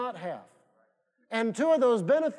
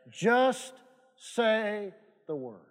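slow, softening punchline; slow emphasis; much slower than usual. Acted. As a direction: let each word land.

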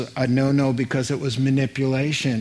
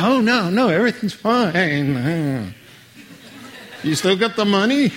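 hammed-up laughing reassurance, starting high and loud; feigned nonchalance; 5 semitones higher, 4 dB louder than usual. Servant assures Naaman all is fine then fishes for money, hammed-up nonchalance. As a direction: play a sly servant brushing off worry.

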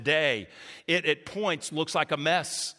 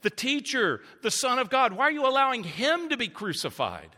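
high, urgent questioning of God; lamenting plea; 6 semitones higher than usual. Retold or imitated. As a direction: cry out why, pitch lifted. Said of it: "Surely that must have been the sentiment".

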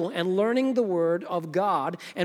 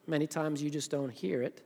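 normal talking voice

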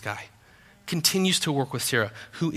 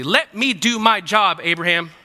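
slow insistent God; 2 semitones higher, 4 dB louder than usual. Acted. God voiced, slowed.

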